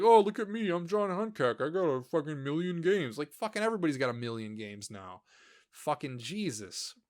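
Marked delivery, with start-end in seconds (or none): 0.0-3.2 higher, smug mock of a collector showing off; mocking braggart. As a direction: sneer it like a boastful show-off. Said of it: "It's like".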